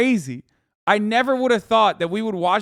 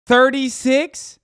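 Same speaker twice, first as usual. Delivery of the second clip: slow, high, louder disbelief; scoffing slow question; incredulous; 6 semitones higher, 6 dB louder, much slower than usual. Real, not acted. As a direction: stretch it out, you can't believe it. Disbelief at the death toll.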